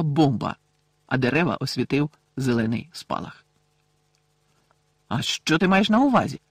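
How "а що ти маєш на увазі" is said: challenging, pitched higher; defiant question. A narrator's reading. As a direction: throw the question back as a challenge. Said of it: "з викликом спитала Герміона".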